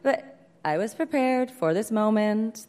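ordinary delivery

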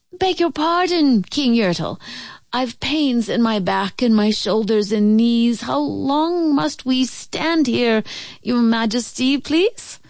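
high, pleading small voice; pitiful, polite plea; meek little turtle. Acted. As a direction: beg timidly of a mighty ruler.

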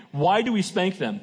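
ordinary talk

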